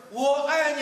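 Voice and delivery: high loud call of love. Acted. Boy shouts I love you across the mountain, loud high.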